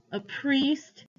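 high-pitched mocking echo. A narrator's reading. Man scorning the boy's trusted priest, mocking. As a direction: lift it into a scornful question. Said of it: "he said mockingly".

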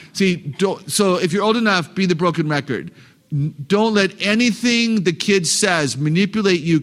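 ordinary delivery

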